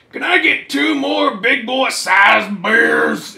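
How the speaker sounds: put-on slow, loud slurring drunk shout; sloppy drunk. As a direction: bellow it like a wasted guy at the counter. Voicing drunk asshole in comic.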